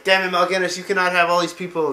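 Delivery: loud, high, acted anger at an enemy; defiant outburst. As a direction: shout it as the furious prince. Arthas defies Mal'Ganis.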